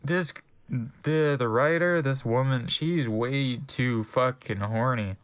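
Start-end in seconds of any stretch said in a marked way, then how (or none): none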